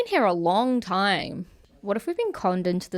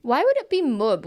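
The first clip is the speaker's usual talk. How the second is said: usual speaking voice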